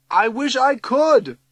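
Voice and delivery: high, loud, drawn-out cry; despairing cry. A narrator's reading. Missus Markham unable to stop the flood, crying out. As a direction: cry out helplessly. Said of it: "cries Missus Markham".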